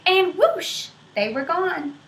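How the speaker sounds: ordinary delivery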